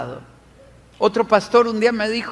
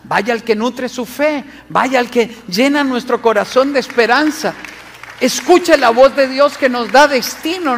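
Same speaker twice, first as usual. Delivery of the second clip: louder, raised-pitch sermon drive; fiery preaching; 3 semitones higher, 4 dB louder than usual. Acted. Preacher urges his flock away from what drains them, in a forceful raised voice. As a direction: preach it hard to the congregation.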